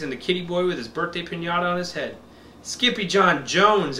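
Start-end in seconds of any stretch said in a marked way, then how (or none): 2.6-4.0 slow, drawn-out call; crying out. Acted. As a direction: call the name out slowly. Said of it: "everyone cried".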